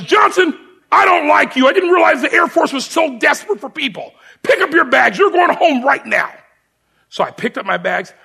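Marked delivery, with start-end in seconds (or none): 0.0-6.3 higher, louder barked orders; retold drill sergeant yell. Sergeant dismissing the recruit, imitated yelling. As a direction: tear into the new recruit.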